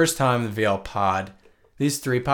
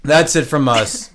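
ordinary talk, nothing marked

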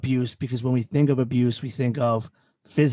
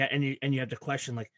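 ordinary delivery

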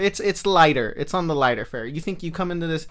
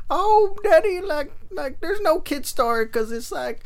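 much higher child voice, sliding into a lightly voiced old man; child turning old man; 13 semitones higher, slower than usual. Reviewer voices a child pleading with daddy, high then a hint of old man. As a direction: start as a pleading kid, drift into an old codger. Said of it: "I transitioned to, like, an old man there".

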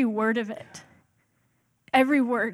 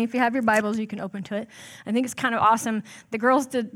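normal talking voice

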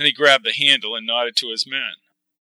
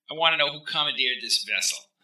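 a touch of stern, soft and tight; demanding official voice; 3 semitones higher, 7 dB quieter than usual. Acted. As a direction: press for answers, lightly voiced. Wiley demands answers.

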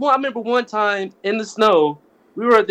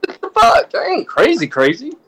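indignant laughing protest; 8 semitones higher than usual. Real, not acted. Protesting being called crazy.

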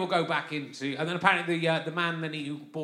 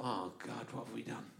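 low, very soft aside; hushed dismay; 6 semitones lower, 14 dB quieter than usual. Retold or imitated. New father recalling first sight of baby, hushed dismay. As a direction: drop to a hushed, dismayed thought.

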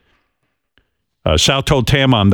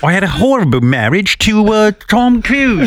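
Nicole Kidman; 10 semitones higher than usual. Pretend. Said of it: "You're supposed to be Nicole".

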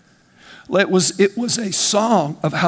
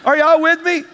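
much higher, fervent preaching; fired-up preaching; 7 semitones higher than usual. Real, not acted. Preacher asks the church if they're with him, fired-up. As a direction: keep the heat up and press the crowd.

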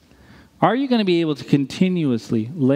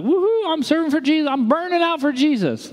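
much higher, giddy hammed-up cheer; hammed-up burnout cheerleader; mock zealot; 12 semitones higher than usual. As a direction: overdo the eager volunteer. Mocking overzealous volunteers.

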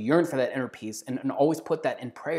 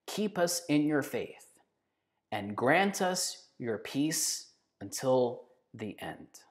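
a soft liturgical petition; slow, fading prayer; 4 dB quieter, much slower than usual. Acted. Reciting a favorite liturgy line, slowly fading. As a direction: pray it slowly, letting it fade out.

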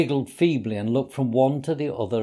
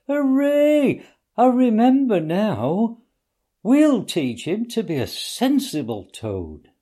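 much higher, louder joyful cheer, slowing down; delighted; 10 semitones higher, 4 dB louder, slower than usual. A narrator's reading. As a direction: cheer like the answer just came to you. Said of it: "cried the Mole delightedly".

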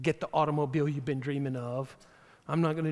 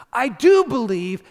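loud plea; 7 semitones higher, 11 dB louder than usual. Acted. Father's cry, read loud.

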